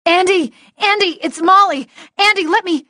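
high, loud urgent calls; calling out. Acted. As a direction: yell through the door, desperate. Pounding on door, calling out.

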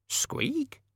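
very high tiny squeak; rat squeak. Acted. Death of Rats squeaks.

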